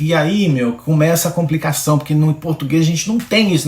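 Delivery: usual speaking voice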